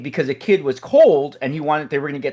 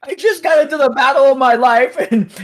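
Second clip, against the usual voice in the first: laughing disbelief; 10 semitones higher, 4 dB louder than usual. Real, not acted. Laughing disbelief retelling absurd moment.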